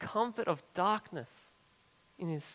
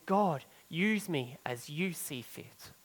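quiet surrender; 4 semitones lower, 7 dB quieter than usual. Retold or imitated. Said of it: "It's going".

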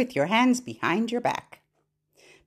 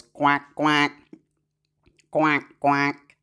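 low duck quacks, evenly spaced; 4 semitones lower, much slower than usual. Shown as a demonstration. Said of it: "mouth of a duck".

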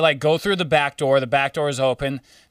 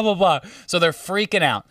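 ordinary talk, nothing marked

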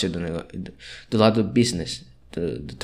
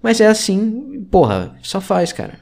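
exasperado; 10 semitones higher, 5 dB louder, slower than usual. Real, not acted. Desabafo irritado sobre a turma.

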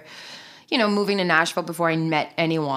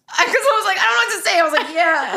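high laughing retelling, louder; laughing while retelling; 11 semitones higher, 6 dB louder than usual. Real, not acted. Friend laughs retelling awkward zit comment, loud and high. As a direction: giggle through the awkward reply. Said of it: "now I gotta have a whole backstory".

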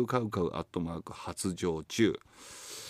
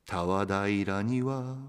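slow, measured poem reading; poem recitation; 4 semitones higher, much slower than usual. Acted. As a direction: savour each phrase slowly. Reads a listener's tanka aloud. (ゆっくり朗読する詩)

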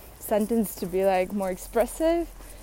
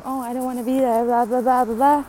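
retold whining scream; 3 semitones higher, 8 dB louder than usual. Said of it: "screaming to the whole classroom".

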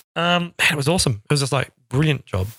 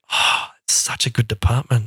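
impressed envy; 5 semitones lower, slower than usual. Retold or imitated. Retold reaction to droid department.

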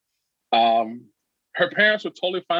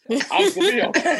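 laughing. Real, not acted. Laughing at own nerves.

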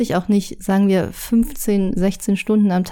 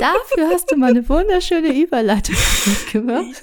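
playful whine, pitched higher; mock offended; 7 semitones higher than usual. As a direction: pretend to sulk about it. Mock complaint about interrupted segue.

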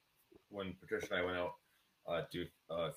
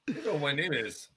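sitcom quote; 9 semitones higher, 8 dB louder, slower than usual. Retold or imitated. Retelling Seinfeld scene.

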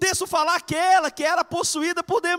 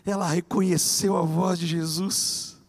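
ordinary delivery

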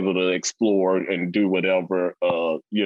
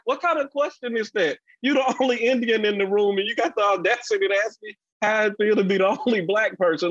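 mock-indignant comeback, pitched much higher; pitched-up disbelief aimed at a rude coworker; incredulous retort; 12 semitones higher, faster than usual. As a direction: fire the question back at him in disbelief.

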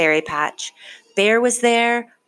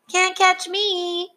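slow, much higher taunting call; teasing shout; 14 semitones higher, slower than usual. A narrator's reading. Fox teases Franklin from inside Bear's house, slow teasing shout. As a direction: taunt him from your hiding spot. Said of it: "he shouted".

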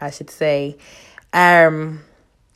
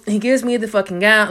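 ordinary talk, nothing marked

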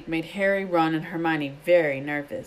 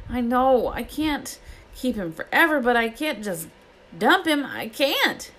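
high, helpless protest; distressed pleading; 7 semitones higher than usual. A narrator's reading. Hagrid cannot bear to dump his dragon, distressed. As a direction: plead in a raised pitch, torn.

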